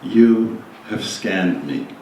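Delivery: slow, low meditative reading with long pauses; slow psalm reading. Real, not acted. Leader reads a psalm for listeners to experience, slowly. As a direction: read it slowly and low, phrase by phrase. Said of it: "I'm gonna read these things slowly".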